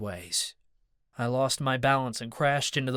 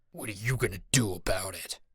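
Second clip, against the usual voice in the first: snarled; 4 semitones lower, 4 dB quieter than usual. A narrator's reading. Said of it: "Mad Dog snarled".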